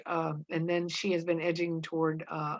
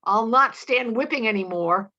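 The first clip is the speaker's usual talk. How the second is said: raised, louder defiance; striving for authority; 5 semitones higher, 7 dB louder than usual. A narrator's reading. Mary Warren facing Proctor's whip, striving for authority. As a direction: stand up to him, pushing for authority. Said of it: "striving for her authority".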